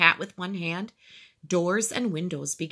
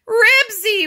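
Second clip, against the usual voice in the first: very high, loud frightened wail; high alarmed cry; 10 dB louder than usual. A narrator's reading. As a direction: wail the dog's name in dread. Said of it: "wailed Henry".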